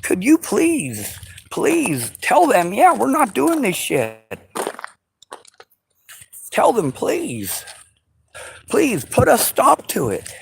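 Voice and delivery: high, slowed, begging plea; pleading. Retold or imitated. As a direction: beg Russia to stop, whining. Voicing own plea to Russia.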